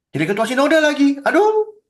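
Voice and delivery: rising high, loud cry of dismay; exasperated. Real, not acted. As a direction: groan in exasperation, pitch leaping up at the end. Exasperated at the synod chairman. (kesal, mengeluh dengan nada tinggi)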